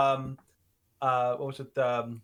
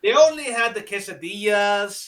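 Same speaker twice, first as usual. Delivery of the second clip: mock Mexican accent; 2 semitones higher, 6 dB louder than usual. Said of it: "See, he can do Mexican".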